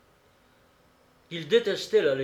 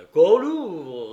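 loud, raised-pitch command; demanding shout; 4 semitones higher, 11 dB louder than usual. A narrator's reading. Mercenaries told of a locked temple, shouted demand. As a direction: shout the order like an impatient mob.

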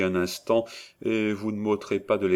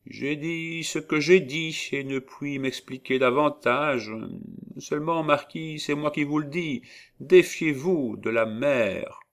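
prophesying witch; 7 semitones higher than usual. A narrator's reading.

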